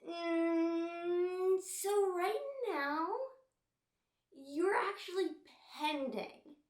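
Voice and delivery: high, drawn-out mock-apologetic gatekeeper; stall, then slowly deliver the bad news; mock check-in person.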